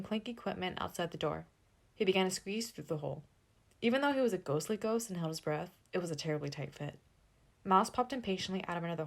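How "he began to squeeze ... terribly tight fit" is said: ordinary delivery, nothing marked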